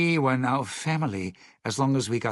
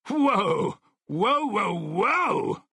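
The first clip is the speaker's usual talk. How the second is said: sudden high startled whoa; 9 semitones higher, much slower than usual. Acted. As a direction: jump back in alarm.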